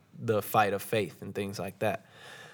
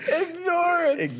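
crying with laughter; 4 dB louder, slower than usual. Real, not acted. Still laughing, slow.

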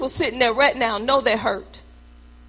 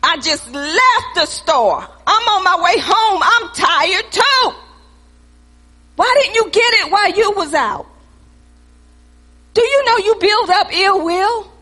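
high, loud, driving pulpit cry; preaching at full force; 5 semitones higher, 3 dB louder than usual. Acted. Pastor calling the church to the altar, at full preaching force. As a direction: lift it up and preach hard at them.

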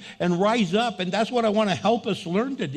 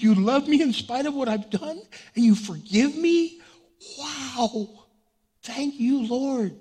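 disbelieving wonder; 5 semitones higher than usual. Retold or imitated. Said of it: "I couldn't believe".

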